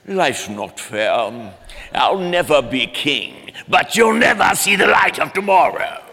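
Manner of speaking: slow villain voice rising to a loud threat; menacing cartoon lion villain; villain uncle taunting the kids; Uncle Scar. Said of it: "I would do Uncle Scar".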